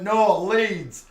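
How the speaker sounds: hammed-up Leeds accent, loud and high; broad Leeds accent. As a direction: mimic a broad Leeds girl answering. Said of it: "her accent was so thick from Leeds".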